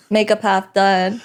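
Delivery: normal talking voice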